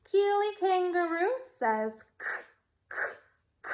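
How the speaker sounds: crisp "k" sound repeated; letter sound k. Shown as a demonstration. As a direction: say "k, k, k" as a short clipped sound. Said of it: "Say it".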